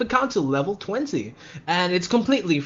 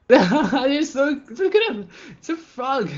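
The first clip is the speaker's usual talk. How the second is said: high, giggly gushing over a cute frog; excited, laughing; 10 semitones higher, slower than usual. Real, not acted. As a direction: squeal with delight at the frog.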